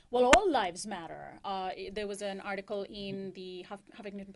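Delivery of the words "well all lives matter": loud mock retort, pitch swooping on "all". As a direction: throw it back as an objection, hitting "all" hard. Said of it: "and who retort".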